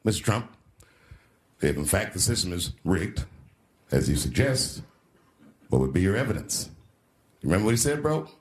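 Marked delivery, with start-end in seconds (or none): 0.0-6.7 lower, measured moderator voice; debate moderator. Retold or imitated. Comic voicing the moderator grilling Trump, lower and formal. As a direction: put on a dry, official newsman tone.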